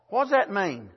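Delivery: normal talking voice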